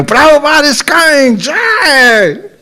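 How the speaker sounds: high, drawn-out jubilant cry; re-enacted euphoric chant of victory; ecstatic cheer. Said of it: "completely in ecstasy".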